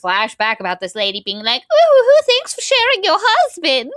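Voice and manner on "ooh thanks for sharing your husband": high, loud, sneering sing-song; catty mock thanks. The other woman taunting the wife, high sneering voice. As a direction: gloat sweetly over the wife. Mocking flashback of husband's lover.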